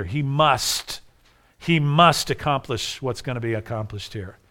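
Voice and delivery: ordinary talk, nothing marked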